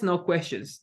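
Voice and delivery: ordinary delivery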